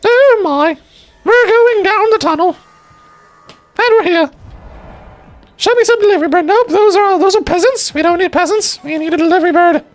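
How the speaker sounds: high-pitched put-on voice, flustered; frantic high character. Explorer heading into the portal, flustered high voice.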